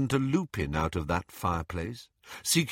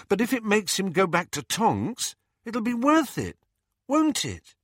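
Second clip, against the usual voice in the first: much higher, hopeful pleading; 12 semitones higher than usual. Acted. Harry seeks reassurance from his friends, pleading. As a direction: let the plea creep in. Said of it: "the plea out of his voice".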